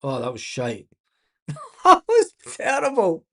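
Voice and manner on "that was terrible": higher, laughing through the words. Real, not acted. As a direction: laugh at how bad it was. Laughs at bad play.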